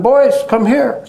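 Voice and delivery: high, slow, coaxing call; gentle call. Retold or imitated. Baba calls two frightened poor boys over, high and slow. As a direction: invite scared boys over kindly.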